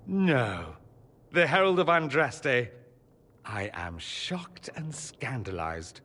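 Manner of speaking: sarcastic. Acted. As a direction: feign scandal, drawing out the last words. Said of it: "Such sarcasm".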